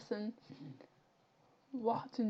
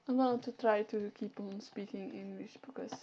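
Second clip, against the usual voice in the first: ordinary talk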